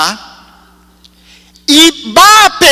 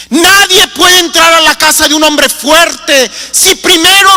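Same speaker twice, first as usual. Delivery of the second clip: preaching at full force; 3 semitones higher than usual. Real, not acted. Predicación con fuerza creciente.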